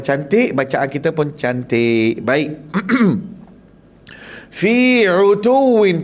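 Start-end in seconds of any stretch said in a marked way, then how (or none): 4.6-6.0 slow Arabic Fi utuwin; Quran recitation. Shown as a demonstration. Teacher recites for the class, stressing the ain. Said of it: "pastikan ain".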